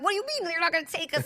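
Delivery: fast, high-pitched retold outrage; pissed off. Rider refused by the Uber driver, pissed off. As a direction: reenact an incredulous, annoyed protest in a rush. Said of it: "I'm just pissed".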